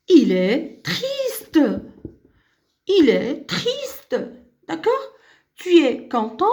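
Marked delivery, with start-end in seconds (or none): 0.1-4.2 very drawn out on the last word; slow modelling. Shown as a demonstration. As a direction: stretch it slowly for the class. Teacher models example sentence.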